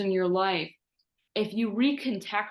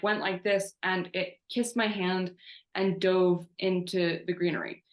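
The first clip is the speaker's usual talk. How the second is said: usual speaking voice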